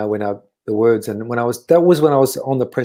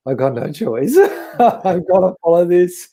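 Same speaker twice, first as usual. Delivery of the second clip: laughing resignation; 5 semitones higher, 3 dB louder, slower than usual. Real, not acted. Laughing at his own fate.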